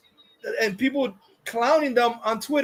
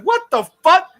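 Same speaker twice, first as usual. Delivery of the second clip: higher, mock-incredulous outburst; incredulous retort; 9 semitones higher, 5 dB louder than usual. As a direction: blurt it out in retold disbelief. Retold incredulous reaction to a claim.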